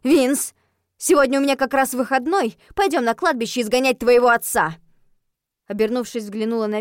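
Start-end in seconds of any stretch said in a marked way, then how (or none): 0.0-4.8 loud, forceful summons; riled up and emphatic; seething. A narrator's reading. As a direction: announce it as an angry threat. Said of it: "Я уже шипела не хуже кошки".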